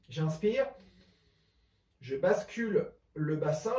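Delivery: normal talking voice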